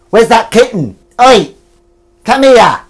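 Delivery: high, loud call; loud Oi to summon the cat; calling the kitten. Real, not acted. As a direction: shout for the kitten to come.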